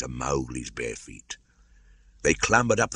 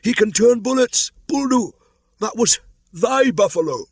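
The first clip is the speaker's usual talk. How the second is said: high, loud jeering cries; mob yelling; 7 dB louder than usual. A narrator's reading. Villagers jeering at Buldeo, loud high yelling. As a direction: shout it across the crowd.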